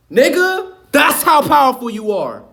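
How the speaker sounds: sudden fired-up outburst, pitched high; shouting. Real, not acted. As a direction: bellow it to wake the crowd up. Hyped preaching about human power.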